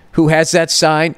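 ordinary talk, nothing marked

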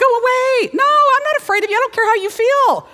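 high-pitched, fearless telling-off; bold mock defiance. [name] facing down Janet across the circle, high defiant voice. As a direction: rattle it off high, brushing the threat away.

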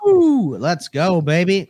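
loud, high whoop of joy; cheering. Real, not acted. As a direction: celebrate like your team won. Cheering the World Series.

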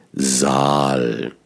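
"Saal" with a voiced s and a long slow a; long warm vowel. Shown as a demonstration. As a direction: stretch "Saal" slowly and warmly. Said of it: "das wärmer ist".

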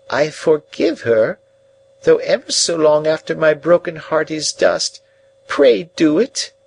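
put-on a broken old woman begging to be forgiven; pleading old lady.

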